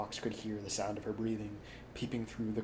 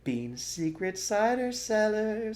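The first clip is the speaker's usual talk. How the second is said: higher, slow relish; savoring fox; 11 semitones higher, 5 dB louder, slower than usual. A narrator's reading. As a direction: roll the words with delight.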